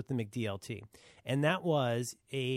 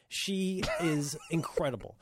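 ordinary talk

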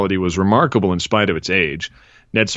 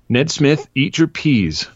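stern mother; 7 semitones higher, slower than usual. Acted. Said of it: "stern expression".

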